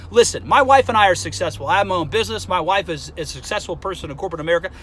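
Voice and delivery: ordinary talk, nothing marked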